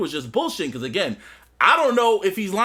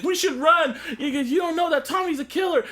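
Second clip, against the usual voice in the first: high-pitched urgent warning; mock a panicked mother urging escape; imitated alarm; 9 semitones higher than usual. Voicing frightened Tasha urging escape.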